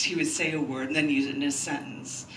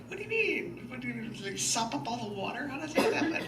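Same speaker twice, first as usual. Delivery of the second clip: high, laughing mock confusion; mock bewilderment; 7 semitones higher than usual. Poet mocking the cops' beach closing, laughing and pitched high. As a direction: play up the silly puzzlement with a laugh. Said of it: "made this funny thing".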